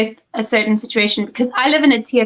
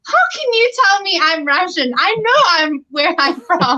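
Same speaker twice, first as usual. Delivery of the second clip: much higher, mock-indignant protest; exasperated retort; 8 semitones higher than usual. Retelling her answer to the arguing ayi, mock exasperated. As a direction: throw up your hands in disbelief.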